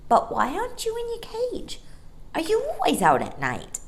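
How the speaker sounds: put-on high, eager boy voice; young boy voice. A narrator's reading. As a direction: speak up brightly like a small boy. Michael's voice in story.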